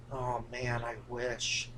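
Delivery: low, soft murmur of longing; wistful imitation. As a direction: imitate envious onlookers under your breath. Imagined ride operators staring wistfully.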